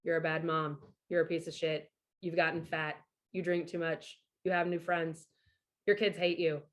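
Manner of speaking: a lightly voiced low, cold inner critic. The ex's voice in her head, low and cold. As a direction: sneer the insults quietly and low. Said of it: "his voice is just like".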